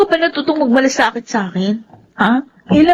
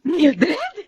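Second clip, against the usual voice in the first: ordinary delivery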